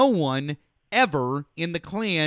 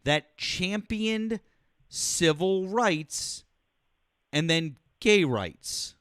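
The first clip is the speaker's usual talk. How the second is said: drawn out word by word, very slow; slow pointed question; slower than usual. Real, not acted. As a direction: lay each word down slowly to corner him. Caller pressing host with pointed question.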